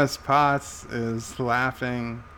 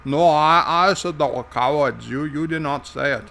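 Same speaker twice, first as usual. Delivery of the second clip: Irish character voice, louder at first; [name]; 4 dB louder than usual. Acted. Irish sidekick correcting the host, in character voice. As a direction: jump in as an Irishman. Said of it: "[name], did I say they were cowards?".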